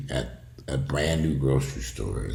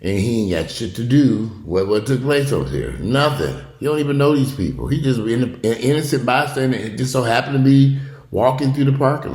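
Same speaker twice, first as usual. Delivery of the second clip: loud outburst; 9 semitones higher, 6 dB louder than usual. Real, not acted. Angry rant about innocent victim.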